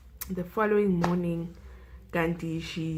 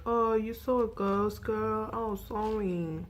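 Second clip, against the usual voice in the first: higher, teasing girls' voice mocking her; mocking tease; 4 semitones higher than usual. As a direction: poke fun at her like a cheeky dorm mate. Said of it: "everyone is laughing at her".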